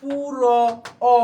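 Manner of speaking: পুর and অ drawn out very slowly; slow pronunciation demo. Shown as a demonstration. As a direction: stretch পুর then অ to show the vowel. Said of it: "বললাম". (ধীরে টেনে পুর, অ উচ্চারণ)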